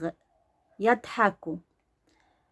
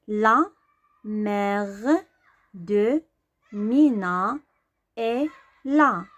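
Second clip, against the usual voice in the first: slow reading; 3 dB louder, much slower than usual. Shown as a demonstration. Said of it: "إي لا كتعني".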